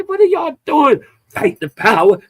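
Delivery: high, incredulous mock question. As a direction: sneer at the slogan, pitched high. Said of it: "And I say".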